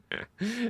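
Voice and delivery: high short laugh, rising; real laugh. Real, not acted. Streamer to chat, laughing at his own story. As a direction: chuckle it off.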